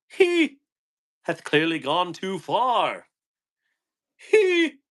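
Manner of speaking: squeaky high theatrical voice; high mock-Shakespearean pronouncement; mock dramatic. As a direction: ham it up like an old stage actor.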